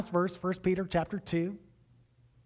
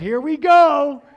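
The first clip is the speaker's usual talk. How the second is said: loud, high, slow and eager; excited; 9 semitones higher, 14 dB louder, slower than usual. Retold or imitated. Preacher reliving his excitement at the opportunity, loud. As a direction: savor the moment out loud.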